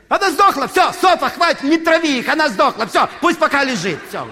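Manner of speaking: высокий, громкий крик коллеге; прокричать коллеге, чтобы бросила это дело; крикливая администраторша. Retold or imitated. Hotel staff shouting about dead mouse. (громко и высоко, скороговоркой кричит в сторону)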